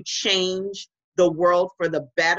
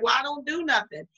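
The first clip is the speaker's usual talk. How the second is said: lightly voiced, higher-pitched objection; higher mock protest; mock naysayer's excuse; 8 semitones higher than usual. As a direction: imitate someone begging off. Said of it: "you're like".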